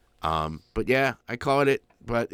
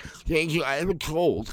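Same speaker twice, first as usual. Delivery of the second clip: higher, pitiful sick-caller voice; thanking the operator in a put-on sickly voice; 7 semitones higher than usual. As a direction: play up being ill on the phone. Explaining his voice on the call.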